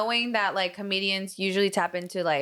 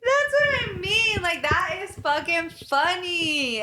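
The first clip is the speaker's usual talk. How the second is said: amused agreement; 7 semitones higher, slower than usual. Real, not acted. Laughing agreement about Chris Rock.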